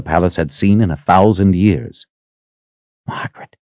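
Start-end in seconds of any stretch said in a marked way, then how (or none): 3.1-3.5 very soft whispered name. A narrator's reading. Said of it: "he whispered".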